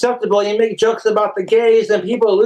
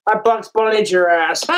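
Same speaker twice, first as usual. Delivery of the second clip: ordinary delivery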